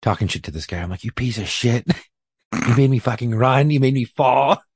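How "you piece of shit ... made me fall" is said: mock angry yelling, a little louder; yelling at him. Officer yelling at the arrested man, half laughing. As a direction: talk trash at the suspect with a laugh. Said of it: "I'm yelling at him".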